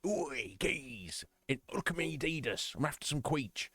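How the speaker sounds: a light London geezer voice, "queech" said kweech; mock geezer. Lightly voiced geezer who is not what the customer was like. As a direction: do a hammed-up cockney geezer asking for kweech. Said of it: "I'm acting like he's a geezer".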